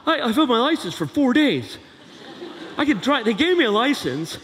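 put-on teen challenging dad over the car; whiny teen. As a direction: argue like a pouty teenager. Teen protesting.

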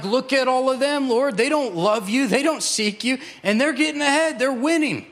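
high-pitched self-pitying complaint; mock pity party. Preacher mimicking his own complaint to the Lord, self-pitying. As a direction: whine to God about everyone else, hammed up. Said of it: "throw myself a pity party".

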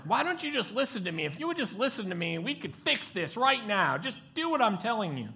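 high, loud exasperated insistence; mock frustrated counselor; exasperated demand. Pastor tells a troubled couple to just listen to him, exasperated.

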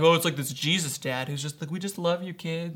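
softly voiced, indulgent softy dad. Mocking the idea of a Jesus dad, lightly voiced softy. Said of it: "it's some softy dad".

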